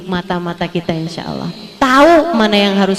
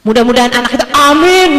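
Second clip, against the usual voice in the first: mock weary amen; 3 dB louder than usual. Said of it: "Kayak aminnya tuh gini ya".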